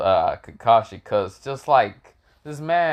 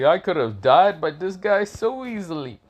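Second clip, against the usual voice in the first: higher-pitched stunned realization; shocked disbelief; 6 semitones higher than usual. Acted. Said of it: "Kakashi damn shocked".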